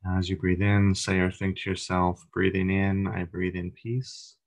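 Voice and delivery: calm meditation leader, long pauses between phrases; guided meditation. Acted. Leader guiding a group through breathing and body relaxation, meditative. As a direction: guide them gently into relaxation, unhurried, leaving room to breathe.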